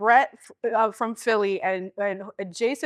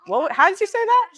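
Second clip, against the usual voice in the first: much higher, louder incredulous mom; imitating mom; 11 semitones higher, 7 dB louder than usual. Imitating her mom questioning her Spanish at home, higher, louder. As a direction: demand it like a shocked mother. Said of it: "my mom was like".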